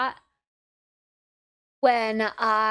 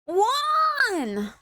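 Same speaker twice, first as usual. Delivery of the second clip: high excited shout; sudden shout; 11 semitones higher than usual. Real, not acted. Sudden exclaimed count.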